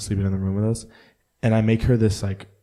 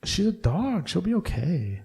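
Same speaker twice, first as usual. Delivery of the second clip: mock reply; 10 semitones higher, slower than usual. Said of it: "I'm like".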